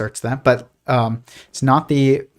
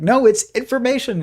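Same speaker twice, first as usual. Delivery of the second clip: higher squeaky cartoon bickering; arguing cartoon physicist; 10 semitones higher than usual. Cartoon physicist arguing with another, higher insistent voice. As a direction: play a cartoon scientist insisting, pitched up. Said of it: "he's like".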